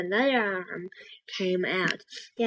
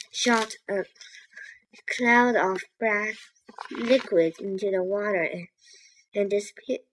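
plain reading voice

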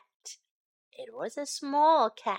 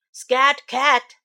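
louder, curt shoo; a barber waving off a stray; firm "Scat Cat", louder; 10 dB louder than usual. Acted.

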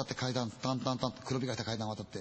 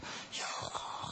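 hushed hesitation; 10 dB quieter, much slower than usual. Retold or imitated. Old man hesitates about the room.

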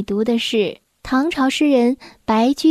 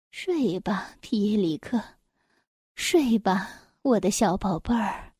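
soft, lowered lullaby voice; low murmur; 5 semitones lower, 7 dB quieter than usual. A narrator's reading. Sleeping woman to her missing baby, low soft murmur. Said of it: "她低声地说着".

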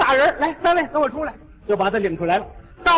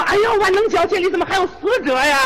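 mock shrill question; 6 semitones higher, faster than usual. 哲理尖声发问.

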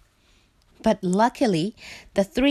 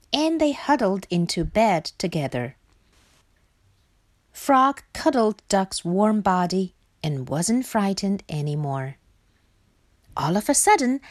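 usual speaking voice

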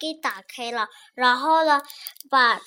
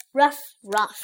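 spoken "ruff, ruff" barks; dog bark; 3 dB louder than usual. A narrator's reading. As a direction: imitate Marley's two short barks.

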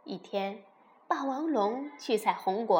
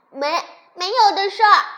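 loud, high stammering denial; indignant rebuttal; 8 semitones higher, 13 dB louder than usual. Acted. Siblings rebut the bragging dinosaur, loud and indignant. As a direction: shout the objection right back. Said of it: "其他甲龙宝宝反驳道".

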